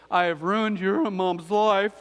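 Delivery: slow, hammed-up tearful kid apology; mock sobbing apology. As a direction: overdo a child's teary sorry, dragging every word. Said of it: "They're crying".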